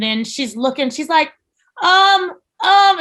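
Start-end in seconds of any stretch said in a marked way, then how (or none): 1.8-3.0 high, loud, panicky stammer; hammed-up alarmed hesitation; mock panicking. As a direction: imitate a squeaky trainee starting to panic. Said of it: "she's panicking the entire time".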